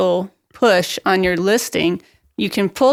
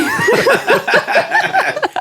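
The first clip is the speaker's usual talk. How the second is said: burst of laughter. Real, not acted. Caught off guard, bursts laughing.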